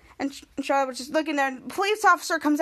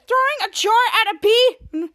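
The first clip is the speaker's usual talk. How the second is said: incredulous scolding; 6 semitones higher, 5 dB louder, slower than usual. Acted. Charlotte scolds Jasper.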